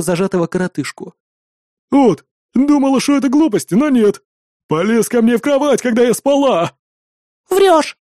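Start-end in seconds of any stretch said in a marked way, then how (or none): 1.9-6.8 Archanka's voice. A narrator's reading. Archanka complains angrily. 7.5-8.0 high, loud shrieking yell. A narrator's reading. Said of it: "заорала одна из женщин".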